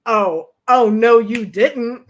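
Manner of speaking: loud, slow sassy protest; indignant. Retold or imitated. Woman answers the host's sexist rule, loud and sassy. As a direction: throw the outrage back at him. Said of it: "I'm just like".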